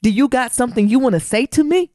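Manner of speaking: mock-stern dad daring his son; confrontational dad. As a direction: challenge him with a rising, pointed question. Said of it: "he said ... you know how you yoke him up".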